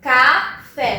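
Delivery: café broken into "ca", "fé", slightly drawn out; teacher separating café as "ca... fé"; split into syllables. Shown as a demonstration. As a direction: say café syllable by syllable, each one distinct. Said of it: "quando eu separo a sílaba".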